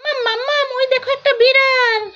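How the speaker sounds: put-on high, eager little-kid voice; child voice. Child calling mummy to see a cat, high and eager. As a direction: play the small child calling mum.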